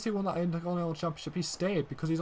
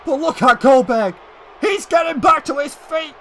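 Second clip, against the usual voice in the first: furious, much higher and louder ringside yelling; outraged shouting; 7 semitones higher, 11 dB louder than usual. Acted. Commentator yelling for security after Reigns' attack, furious shout. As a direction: scream at security like the attack just happened in front of you.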